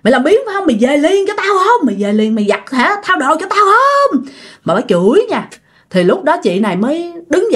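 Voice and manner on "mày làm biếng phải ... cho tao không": high-pitched angry nagging, imitated; retold tirade of a cursing stepmother; stepmother's scolding. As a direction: scold a lazy stepchild in a high, pushed voice. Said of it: "Bà chửi quá trời chửi luôn".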